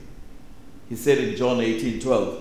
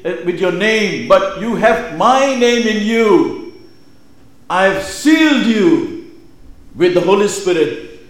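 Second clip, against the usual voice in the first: loud, higher-pitched, emphatic proclamation; preach; 7 semitones higher, 8 dB louder than usual. Acted. Preacher voicing Jesus sending believers to the community, impassioned preaching. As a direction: declare it with weight, stretching the key phrases.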